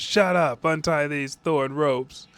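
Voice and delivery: high, loud furious shout at his captor; angry yell. Retold or imitated. Tied-up boy yells angrily at his captor, high and loud. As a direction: snap it out, tied up and fed up.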